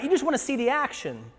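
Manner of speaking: acted exasperated jab; scolding older brother. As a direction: accuse the kid brother.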